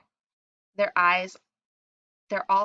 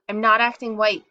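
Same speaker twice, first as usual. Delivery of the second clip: loud, indignant denial; emphatic near-shout; 3 semitones higher, 9 dB louder than usual. A narrator's reading. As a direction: raise the voice in protest. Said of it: "I want to shout".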